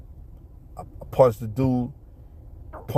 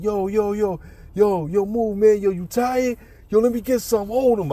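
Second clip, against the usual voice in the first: high eager friend voice; imitating the eager friend, higher pitch; friend cutting in; 9 semitones higher than usual. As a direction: jump in excited, wanting a turn. Friend eager to join fight.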